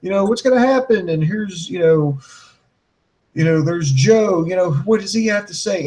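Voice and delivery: high, mock hype sales voice; mocking WWE pitch. Host mocking WWE's hype, high sarcastic. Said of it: "It's like".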